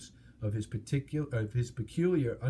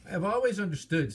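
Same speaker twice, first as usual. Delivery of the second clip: higher, timid squeak; frightened squeak; 8 semitones higher than usual. Acted. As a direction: squeak it out like a scared hobbit. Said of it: "in a frightened squeak".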